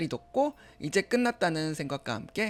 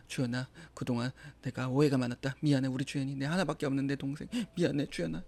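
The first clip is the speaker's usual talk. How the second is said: tender apology; 4 semitones lower, 5 dB quieter than usual. Acted. Brother apologizing softly.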